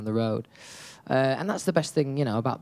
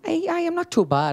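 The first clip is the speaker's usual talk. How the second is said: put-on quick Irish accent, pitched up high. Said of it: "Can you do an Irish accent".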